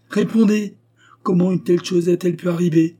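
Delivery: sharp, high, loud demand for an answer; angry demand. Acted. Governess Anna demanding an answer from Letty, angry. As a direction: insist furiously on a reply. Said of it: "répéta Anna, frappant le papier".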